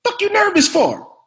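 loud, high incredulous demand; Sam Jackson. Retold or imitated. Star questioning a rookie who admits nerves, exasperated. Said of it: "He said".

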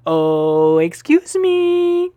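high squeaky voice. Acted. Plays desk visitor in game.

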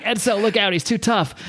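high-pitched mock alarm; urgent warning. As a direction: call out a warning, lightly acted. Acts Kevin shouting a warning.

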